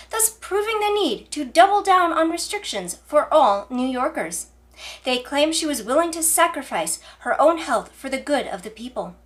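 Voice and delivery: usual speaking voice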